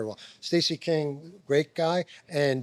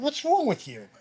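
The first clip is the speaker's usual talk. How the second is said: retold indignant challenge; 9 semitones higher than usual. Retold confrontation on court.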